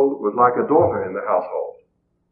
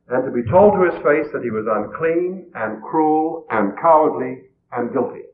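normal talking voice

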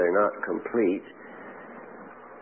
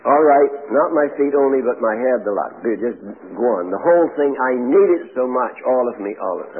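eager Peter; 5 semitones higher, 5 dB louder than usual. Retold or imitated. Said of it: "He said".

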